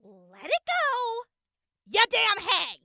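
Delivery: high, slow and sneering; slow scornful retort. Acted. Snapping back at the old hag, slow and scornful. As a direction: drag it out to mock her.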